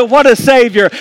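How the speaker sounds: rising sermon climax, calling out to the church; preaching at full force. Acted. Pastor exhorting the congregation to celebrate, at full force.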